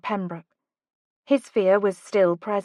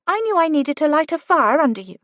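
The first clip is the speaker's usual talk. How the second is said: put-on lightly higher, urgent young woman; Kate's voice; 7 semitones higher, 5 dB louder, faster than usual. A narrator's reading. Kate urging Oliver to go, lightly higher voice. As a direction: push him, brisk and bright.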